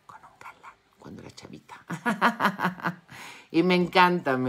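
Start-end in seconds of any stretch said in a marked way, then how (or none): 0.1-1.7 whispered confession; half-hidden gossip whisper. Acted. Confessing an old boyfriend. 1.8-3.4 amused laugh after a cheeky aside; giggle, a little embarrassed; high laugh. Real, not acted.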